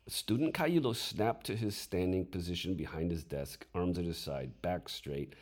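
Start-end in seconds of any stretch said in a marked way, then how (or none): none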